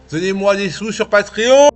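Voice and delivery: loud cheeky plea; playful shout. Acted. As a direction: shout it out with a grin. Joking plea for Patreon money.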